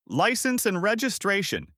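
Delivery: a high, sharp cop's command; barked order. A narrator's reading. Cop barking at a stopped driver, sharp and high. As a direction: snap it out like a cop who owns the road. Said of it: "he barked".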